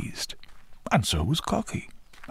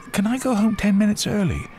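put-on high, louder boy's request; braver young boy; 6 dB louder than usual. A narrator's reading. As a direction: ask boldly in a high boy's voice. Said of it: "he was feeling braver".

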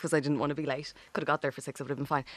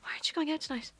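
mum's voice; 6 semitones higher, 7 dB quieter than usual. Retold or imitated. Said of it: "she'd be like".

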